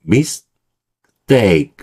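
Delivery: "mistake" broken slowly into mis... dake; split mistake. Shown as a demonstration. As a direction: say "mistake" in two slow parts, tense t. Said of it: "앞에 있는".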